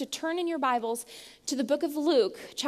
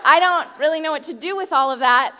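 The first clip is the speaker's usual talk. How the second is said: usual speaking voice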